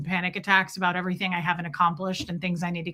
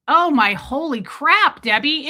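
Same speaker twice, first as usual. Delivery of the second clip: exclaiming; 5 semitones higher, 7 dB louder than usual. Real, not acted. Reacting loudly to chat.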